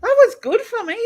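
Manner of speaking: laughing through the words; giggling while talking. Real, not acted.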